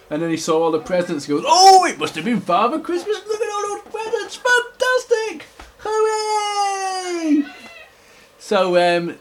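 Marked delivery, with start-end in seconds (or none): 1.5-7.4 very high, excited bear squeal; overjoyed storybook character; delighted discovery. Acted. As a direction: rise into a long high cheer. Delighted at seeing the presents.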